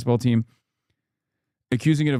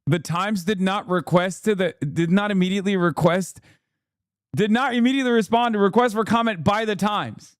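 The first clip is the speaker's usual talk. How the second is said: pitched-up disbelief at the line; incredulous; 7 semitones higher than usual. Real, not acted. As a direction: read it back as if it can't be true. Rereading absurd news line incredulously.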